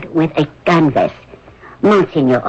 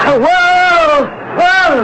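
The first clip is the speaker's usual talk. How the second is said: calling to horses; 12 semitones higher, much slower than usual. Acted. Reining in the horses.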